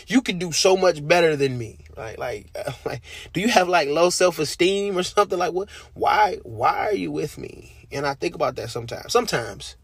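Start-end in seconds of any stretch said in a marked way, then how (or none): 0.1-1.8 mock disbelief. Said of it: "I'll be like". 3.3-7.9 incredulous. Real, not acted. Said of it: "incredulous at God's love".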